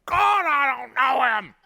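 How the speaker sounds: high, loud, slow desperate oath; desperate swearing. Acted. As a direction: swear it loud, pleading not to be known. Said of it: "he began to swear and affirm oaths".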